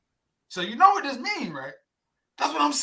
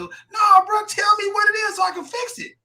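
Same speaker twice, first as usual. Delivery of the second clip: loud, higher shout of frustration; demanding shout; 9 semitones higher, 3 dB louder than usual. Retold or imitated. Man yelling at his friends to tell him straight.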